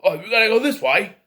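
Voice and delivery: loud, high mock-Cockney holler; imitated Cockney accent. Reviewer mimicking the actor's film accent, loud mock Cockney. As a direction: call out urgently in put-on Cockney. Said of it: "doing some sort of Cockney accent".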